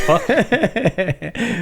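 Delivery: Cockney accent. Shown as a demonstration. Co-host showing what Cockney would sound like, mock Cockney. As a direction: stretch it out in a jokey Cockney accent. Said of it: "If you were doing Cockney".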